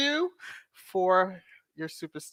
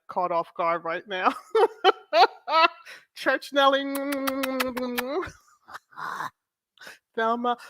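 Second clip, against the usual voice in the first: ordinary talk, nothing marked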